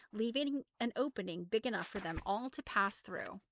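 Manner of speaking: ordinary delivery, nothing marked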